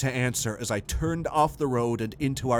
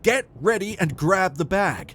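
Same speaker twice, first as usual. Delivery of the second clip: raised, insistent command; urgent order; 7 semitones higher, 4 dB louder than usual. A narrator's reading. Husband telling wife to get ready to flee, urgent. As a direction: press her to move now.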